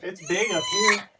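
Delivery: high, loud, slow call from inside; excited shout. Real, not acted.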